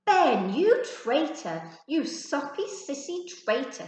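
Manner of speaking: loud, high, slow scornful yell; disgusted shout. Acted. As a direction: spit out the insult in disgust. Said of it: "said Stephen with disgust".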